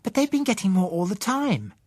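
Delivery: put-on high, light child voice, surprised; a girl amazed anyone missed it; young girl voice. Young Susan surprised the adults never noticed, high child voice. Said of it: "Susan looked surprised".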